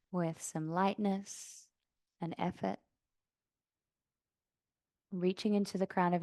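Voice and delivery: calm yoga-teacher meditation voice, long pauses; soft, settled breathing-practice guidance; guided meditation. Real, not acted. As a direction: guide them gently into stillness, unhurried. Leads seated breathing meditation.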